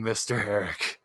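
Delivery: ordinary talk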